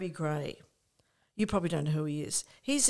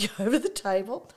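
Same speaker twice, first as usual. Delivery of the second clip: plain reading voice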